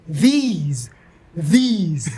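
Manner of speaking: "these" drawn out, each alone; practising diction. Retold or imitated. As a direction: rehearse a long, careful "these". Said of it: "the whole time I was like".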